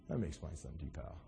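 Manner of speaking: hushed, low, smirking reply; talking real low. Acted. Jesus smirking at Pilate, talking real low. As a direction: speak softly with a smirk. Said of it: "You know, talking real low".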